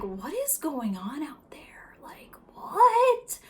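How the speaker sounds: slow unvoiced whisper; puzzled whisper to herself. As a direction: breathe the question without voice. Confused teen whispering to herself.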